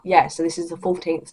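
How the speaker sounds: usual speaking voice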